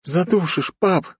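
put-on high girlish protest; embarrassed daughter. A narrator's reading. Daughter squeezed in father's hug, embarrassed high voice. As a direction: protest shyly in a girl's high voice. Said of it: "засмущалась девушка".

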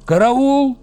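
yelling for help. A narrator's reading. Quadrigo yells for help in the dark, high and panicked. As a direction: holler for help. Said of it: "заорал Квадриго".

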